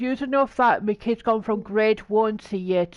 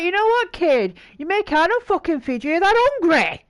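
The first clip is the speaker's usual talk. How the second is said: high, worked-up parental rant; mock exasperation at a child, pitched up; indignant telling-off; 6 semitones higher, 4 dB louder than usual. Friend voicing a fed-up parent to the kid, indignant.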